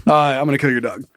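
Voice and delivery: quick matter-of-fact warning; fast deadpan threat. Retold or imitated. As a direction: rattle it off fast. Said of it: "I said".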